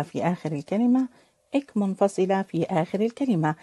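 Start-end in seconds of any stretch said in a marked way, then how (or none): none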